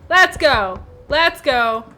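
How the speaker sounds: loud, punchy goading; psyched-up challenge. Real, not acted. Player daring the monster to come, loud challenge. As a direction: dare it at full voice.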